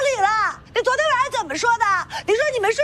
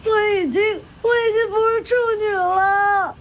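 sobbing through the words; tearful confession. Acted. A young woman tells the old aunt what happened, tearful. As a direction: confess it slowly, crying.

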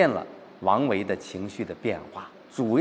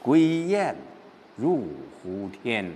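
gloomy recitation; much slower than usual. Shown as a demonstration. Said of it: "这还是在郁闷当中".

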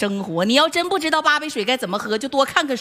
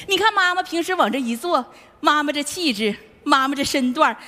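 usual speaking voice

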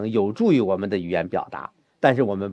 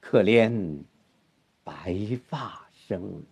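soft, slow, dying away on 白发生; fading whisper-like; 4 semitones lower, 6 dB quieter, much slower than usual. Shown as a demonstration. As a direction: let it trail off very softly. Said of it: "那就悲切了". (渐弱，极轻极慢)

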